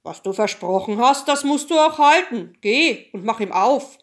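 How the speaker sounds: loud, firm king's voice; commanding king. A narrator's reading. King orders daughter to keep her promise, loud and firm. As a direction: lay down the rule, loudly. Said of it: "Da sagte der König".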